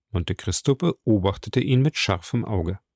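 usual speaking voice

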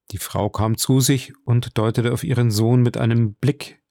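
plain reading voice

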